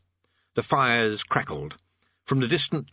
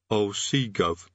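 distant underling; much slower than usual. A narrator's reading. Distant underling answers the king.

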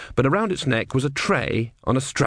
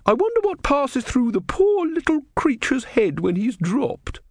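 much higher woman's voice; pitying woman. A narrator's reading. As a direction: lift it high with pity for the creature. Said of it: "said the woman".

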